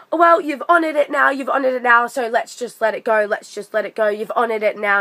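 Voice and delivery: high and loud at first, then settling down; smug, insistent repetition to cut him off; hammed-up pushy customer; mocking the daughter. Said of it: "she starts saying".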